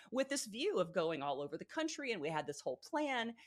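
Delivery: ordinary talk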